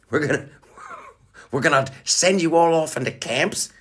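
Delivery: high-pitched mock politician with rising phrase endings; hammed-up tyrant issuing lockdown orders, pitched high; psychopathic prime minister. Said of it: "her being a total fucking psychopath".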